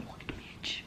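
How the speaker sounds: breathy incredulous whisper; whispered aside. Real, not acted. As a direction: mutter it under your breath, stunned. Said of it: "incredulous whispered aside".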